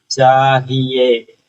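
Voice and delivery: चाहिए very slow with a short ही; teacher modelling चाहिए slowly; slow sounding out. Shown as a demonstration. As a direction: drag चाहिए out syllable by syllable. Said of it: "हा पर छोटी मात्रा".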